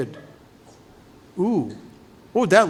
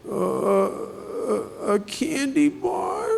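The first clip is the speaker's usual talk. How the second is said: put-on slow, high, small voice of a scared girl; timid little girl; 8 semitones higher, 5 dB quieter, much slower than usual. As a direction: voice a frightened child, high and hesitant. Frightened girl answers paramedic.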